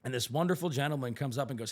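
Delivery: normal talking voice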